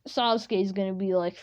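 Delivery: ordinary talk, nothing marked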